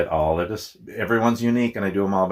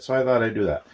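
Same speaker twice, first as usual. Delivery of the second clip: ordinary talk, nothing marked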